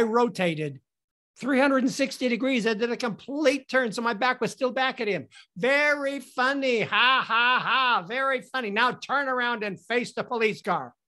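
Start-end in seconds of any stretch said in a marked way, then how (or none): 5.6-10.9 raised voice, deliberate mock laugh; unamused sarcasm. Cop to the man who spun full circle, unamused sarcasm. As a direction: spell out each "ha" with sarcasm.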